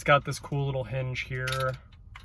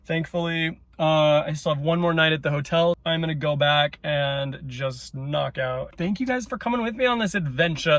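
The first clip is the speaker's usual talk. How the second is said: normal talking voice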